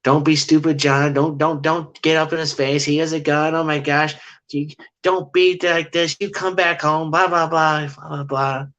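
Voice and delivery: high-pitched mock nagging wife, scolding fast. Mimicking the female Sasquatch scolding her mate, high and nagging. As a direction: play a wife nagging her husband to back off. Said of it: "female was bickering like that married couple".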